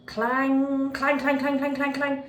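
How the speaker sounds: loud, ringing mock bell clangs; bell clanging. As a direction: ring it out like a little hand bell. Child ringing the bell repeatedly.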